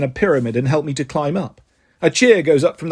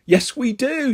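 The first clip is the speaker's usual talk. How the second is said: mild shout; 7 semitones higher, slower than usual. A narrator's reading. Andys defending Terry to Andy, mildly shouted. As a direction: insist back in a higher voice. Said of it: "shout the Andys".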